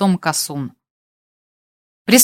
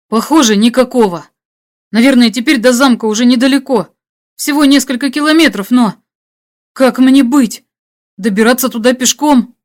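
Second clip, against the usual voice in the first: louder, higher, worried questioning; tense plea for advice; hunted hero, at a loss; 4 semitones higher, 5 dB louder than usual. Acted. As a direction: think aloud anxiously over the radio.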